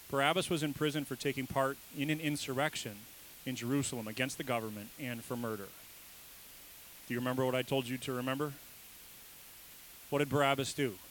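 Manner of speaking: ordinary delivery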